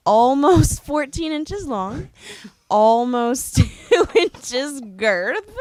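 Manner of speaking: laughing while reading. Real, not acted. Reading an absurd toy listing.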